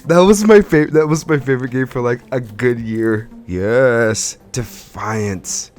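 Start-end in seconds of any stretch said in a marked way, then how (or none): none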